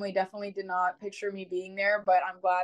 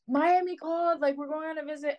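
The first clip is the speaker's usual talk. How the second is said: excited; 7 semitones higher than usual. Retold or imitated. Runs in to tell family.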